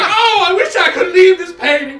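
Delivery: high, loud, laughing wail; high loud lament from the painting; whiny painting man. Acted. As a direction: moan like a trapped portrait. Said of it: "And he's like".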